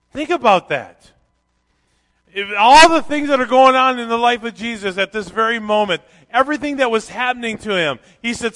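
loud, high, driving sermon climax; preaching at full force. Real, not acted. Pastor urging the congregation to consider Jesus' suffering, preaching loudly. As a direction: press the congregation hard.

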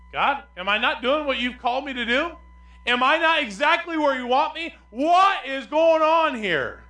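loud, high pleading complaint, last line slowed; frustrated protest. Acted. Frustrated husband complains loudly to God about no child. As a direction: cry out at God, exasperated.

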